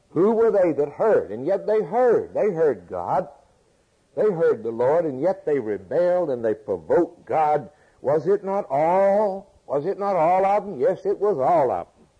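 loud, high, hammering rhetorical questions; preaching at full force. Acted. Preacher pressing the congregation with questions, loud and high. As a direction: drive each question home to the congregation.

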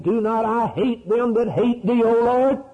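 high, rising to a loud finish; fervent cry. Acted. Preacher quoting David to the Lord, fervent cry. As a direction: cry it out to God with fervor.

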